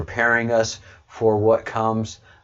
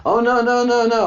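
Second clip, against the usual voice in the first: mock protest; 13 semitones higher, 5 dB louder than usual. Real, not acted. Laughing denial of blame.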